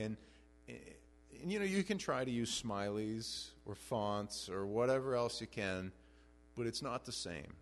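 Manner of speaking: ordinary talk, nothing marked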